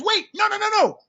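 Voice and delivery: high, insistent run of no's; retold cut-in, talking over someone; interrupting. Grandfather interrupting his grandson's answer, insistent. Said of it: "He interrupted me again".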